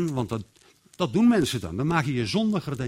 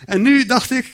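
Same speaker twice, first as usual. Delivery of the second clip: ordinary talk, nothing marked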